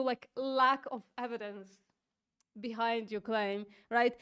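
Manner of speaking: ordinary delivery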